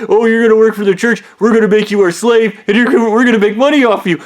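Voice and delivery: high, loud, rushed mock pitch; mocking imitation. Mockingly acts out the church's offer.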